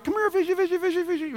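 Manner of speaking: high sing-song fish call; coaxing fish. Pretend. Angler calling to a fish, high sing-song coax. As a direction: coo to the fish to come.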